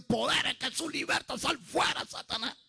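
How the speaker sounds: imitating churchgoers casting out Satan; high-pitched urgent exorcism command; mock deliverance rebuke. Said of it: "los que conocían la Biblia decían".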